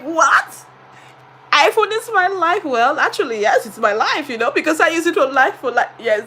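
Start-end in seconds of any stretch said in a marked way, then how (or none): 0.0-2.6 surprised retort. Retold or imitated. Teacher answers the children, surprised. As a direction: question it in disbelief. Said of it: "I was like".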